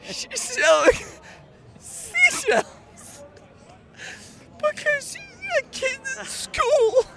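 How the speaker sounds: put-on high, wobbly old granny voice; grandma voice. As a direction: talk like a sweet old grandma. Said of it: "why are you using your grandma voice?".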